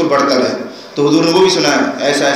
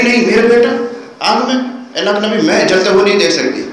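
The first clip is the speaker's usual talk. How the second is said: higher, pleading mother's cry; mother's frantic plea; 7 semitones higher than usual. Retold or imitated. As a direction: beg the Prophet to spare your son. Mother begs not to burn son.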